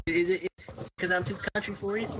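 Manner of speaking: put-on country accent. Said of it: "It sounds a country accent".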